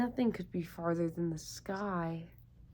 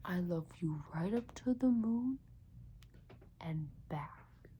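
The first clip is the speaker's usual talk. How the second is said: soft whisper fading to nothing; tender bedtime whisper; whispered with a smile; 3 semitones lower, 6 dB quieter than usual. Acted. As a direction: whisper it over a sleeping child. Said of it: "and whispered with a smile".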